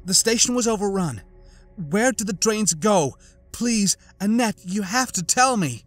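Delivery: urgent plea. A narrator's reading. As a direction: press her for the answer. The girl urgently pleads.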